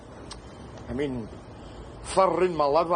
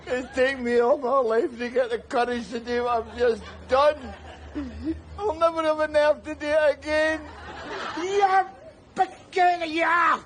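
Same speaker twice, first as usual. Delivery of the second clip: desperate urging; 8 semitones higher than usual. Acted. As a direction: shake him awake with your voice. Trying to revive rescued man.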